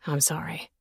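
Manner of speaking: hoarse rasping whisper of an apology; grated whisper. A narrator's reading. Man forcing out a whispered apology. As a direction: grate the apology out under your breath. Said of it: "he grated".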